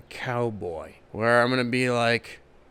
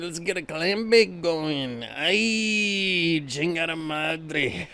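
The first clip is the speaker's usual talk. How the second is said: hammed-up Mexican accent, higher and partying; Mexican beach bum; 6 semitones higher than usual. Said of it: "I'll turn it into a Mexican beach bum".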